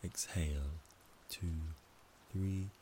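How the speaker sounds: calm, measured count with pauses; slow breath counting. Shown as a demonstration. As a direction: count each breath slowly and evenly. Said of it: "It goes like this".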